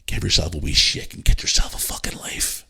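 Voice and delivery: whispered, scornful aside; telling a troll to get a life in a whisper; whispered jab. Real, not acted. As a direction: hiss it at the troll.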